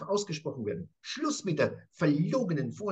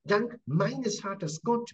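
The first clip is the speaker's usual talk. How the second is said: reverent; 6 semitones higher, much slower than usual. Acted. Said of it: "das finde ich so schön".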